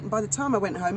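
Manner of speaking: ordinary delivery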